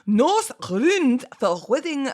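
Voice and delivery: put-on slow, playful Scottish accent on "Norse runes for wedding". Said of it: "I have to say it in a Scottish accent".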